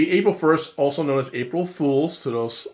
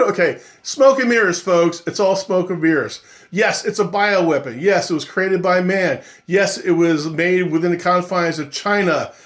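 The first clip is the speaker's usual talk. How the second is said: ordinary talk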